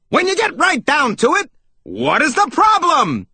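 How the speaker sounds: high, brash interrupting holler; high-pitched, punchy demand; pushy salesmouse. A narrator's reading. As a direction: barge in with a big cartoon voice. Pushy salesmouse cuts in loudly.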